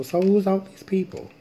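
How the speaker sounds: suddenly thrown by all the faces in the cards; puzzled surprise. Real, not acted.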